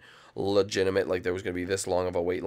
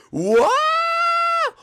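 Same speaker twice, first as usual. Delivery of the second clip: very high, loud mock scream; hammed-up rollercoaster scream; terrified shriek; 9 dB louder than usual. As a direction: shriek as the ride takes off. Mock terror as ride speeds up.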